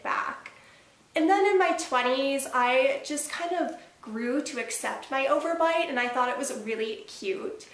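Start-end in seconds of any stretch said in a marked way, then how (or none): none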